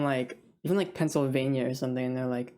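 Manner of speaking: ordinary delivery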